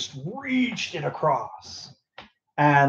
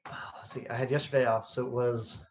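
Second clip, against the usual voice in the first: whispered aside; 9 dB quieter than usual. Real, not acted. Muttering while checking phone game.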